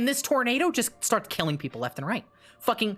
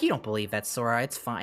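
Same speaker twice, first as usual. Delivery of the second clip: a much lower calm Riku; deep Riku voice; 8 semitones lower than usual. Retold or imitated. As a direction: lower the voice to reassure Sora. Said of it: "Riku's like".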